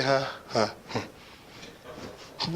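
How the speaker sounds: low, mumbled tired grunts. Retold or imitated. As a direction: grunt half-heartedly like a worn-out husband. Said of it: "Not quite like that, but something like it".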